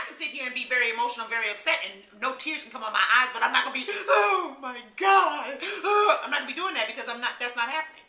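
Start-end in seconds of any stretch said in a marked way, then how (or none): 3.9-6.2 hammed-up fake wail, very slow, laughing through it; mock fake crying. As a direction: mimic someone faking tears, drawn out and mocking. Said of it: "if you're acting like you're crying".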